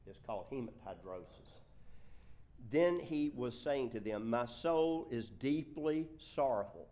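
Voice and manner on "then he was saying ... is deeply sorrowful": loud, slow weight on "deeply sorrowful"; projected, weighty scripture reading; emphatic. Acted. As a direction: lean hard on "deeply" and "sorrowful". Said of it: "That is emphatic".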